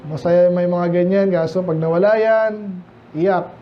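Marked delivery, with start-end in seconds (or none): none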